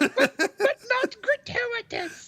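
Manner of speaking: very high, slow falsetto; slow mock falsetto mother; ghost mother voice. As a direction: draw it out in a squeaky mum voice.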